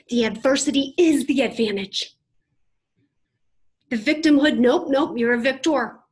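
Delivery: loud, rising motivational cry; rousing shout. Real, not acted. Speaker firing up the audience, loud and rousing. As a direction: preach it to the back row.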